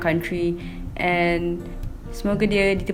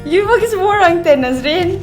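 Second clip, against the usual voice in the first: surprised protest; 11 semitones higher, 5 dB louder than usual. Real, not acted. Protesting that everyone gets ten.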